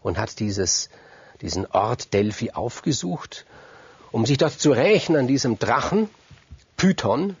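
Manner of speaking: ordinary delivery, nothing marked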